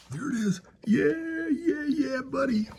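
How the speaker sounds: high, thrilled cry; excited. Real, not acted. Excited at seeing his van.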